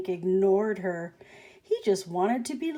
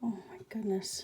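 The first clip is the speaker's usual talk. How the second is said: hushed gasp of an aside; whisper; 9 dB quieter, faster than usual. Real, not acted. Teacher whispering to the children about the frightened tortoise. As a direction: whisper it, wide-eyed.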